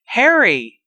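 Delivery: loud, high scream of his name; loud high frightened call. Acted. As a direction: scream for him in alarm. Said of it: "Aunt Petunia screamed".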